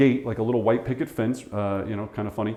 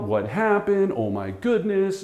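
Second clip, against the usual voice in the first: a touch of retold panic, pitched higher; lightly replaying the shock; freaked out; 7 semitones higher than usual. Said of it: "you're kind of freaked out".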